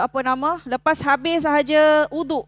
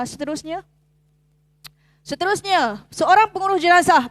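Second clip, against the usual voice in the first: ordinary delivery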